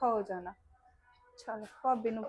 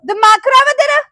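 much higher, much louder outraged shout; furious shout; 12 semitones higher, 19 dB louder than usual. Acted. Girlfriend screaming at boyfriend who broke it off. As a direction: scream it in disbelief.

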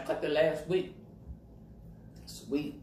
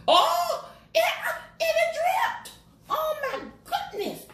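much higher, loud startled cry; surprised shriek; 4 dB louder, slower than usual. Real, not acted. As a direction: yelp as the food drips. Food drips on her shirt.

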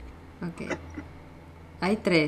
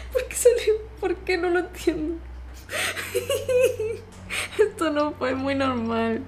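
high, shaky laughing disbelief; laugh-cry after a jumpscare; nervous laughter; 11 semitones higher than usual. Real, not acted. Jumpscare, loses the game.

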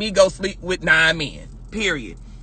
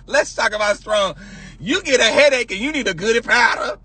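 ordinary talk

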